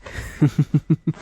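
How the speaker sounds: short triumphant giggle on finding him; gleeful laugh. Acted. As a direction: laugh like you've caught your prey. Finds hidden captive.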